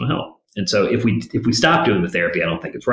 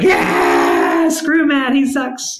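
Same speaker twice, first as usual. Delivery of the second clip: high, loud mock crowd cheer; crowd going nuts; 7 dB louder, much slower than usual. Three hundred audience members cheering for the old lady, loud high mock cheer. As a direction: yell it like a whole audience cheering. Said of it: "The place went nuts".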